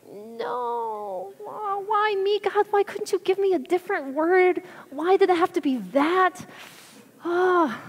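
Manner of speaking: high, whiny plea; mock whine. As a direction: whine up at God like a kid. Whining to God, retold.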